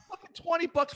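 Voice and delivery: mock outraged squawk, pitched far up; high incredulous mock outrage; indignant. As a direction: retell the buddy's disbelief at the cash. Buddy outraged at tiny budget.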